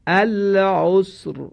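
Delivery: العسر with the final ر heavy and thick, recited slowly; heavy Quranic r. Shown as a demonstration. Quran teacher models the word for the class, slow and heavy. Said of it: "باید دقت کنید که را تفخیم بشه".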